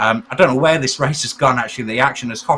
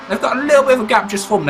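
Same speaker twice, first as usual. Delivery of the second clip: excited; 9 semitones higher, 3 dB louder, faster than usual. Real, not acted. Gap opens live.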